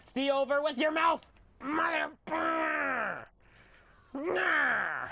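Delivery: high, loud gamer shriek; hammed-up screaming outburst; freak-out scream. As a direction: freak out at full pitch. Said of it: "I can scream all I want and freak out".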